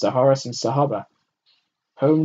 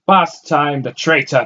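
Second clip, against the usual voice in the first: loud, high contemptuous spit; spat out; 5 semitones higher, 10 dB louder, slower than usual. A narrator's reading. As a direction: spit the words with scorn. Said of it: "the man spat".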